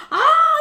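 high, loud mock cry of pain; pained yelp. Friend imitates the burned man's yelp, laughing. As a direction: yelp like your scalp is burning. Man burned by poison apple.

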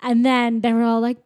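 usual speaking voice

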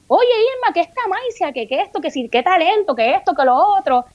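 high mock raving that runs on; imitating mother's admirers. Admirers praising the girl to her mother, mock gushing. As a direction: rattle off praise like a gushing neighbour. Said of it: "mi mamá se llama Irma".

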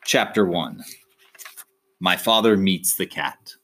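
plain reading voice

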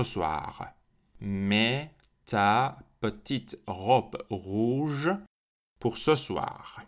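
very slow, drawn-out command; slow imperative model. Shown as a demonstration. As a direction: stretch out every word.

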